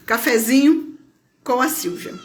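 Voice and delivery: usual speaking voice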